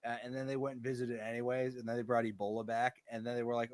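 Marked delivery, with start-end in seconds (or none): none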